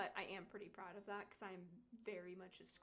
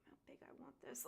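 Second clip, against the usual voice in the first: softer whispered scheming; 4 semitones higher, 6 dB quieter than usual. Retold or imitated. Planning her own big tattoo, whispered. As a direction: whisper it like a secret plan. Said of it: "I'm like".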